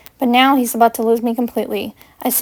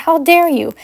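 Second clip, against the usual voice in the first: angry; 6 semitones higher than usual. Acted. Furious quarrel with Severus.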